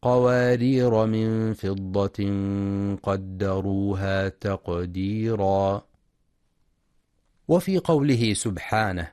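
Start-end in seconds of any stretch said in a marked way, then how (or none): 0.0-5.9 slow قوارير with no final a, flowing on; slow recitation, قوارير with no a; alif dropped. Shown as a demonstration. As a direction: read قوارير without alif, slowly. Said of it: "فتقرأ هكذا".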